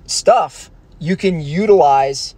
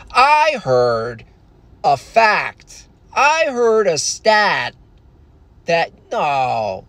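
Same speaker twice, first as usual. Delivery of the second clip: slow, pitched-up mock host voice; mock podcast host; 3 semitones higher, much slower than usual. Mocking a podcast host, slow and pitched-up. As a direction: draw out the words like a smug pundit. Mocking Joe Rogan pose.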